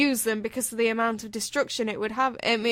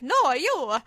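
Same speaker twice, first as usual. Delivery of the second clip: mock Mario; 4 semitones higher, 6 dB louder than usual. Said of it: "Mario comes on stage and he's like".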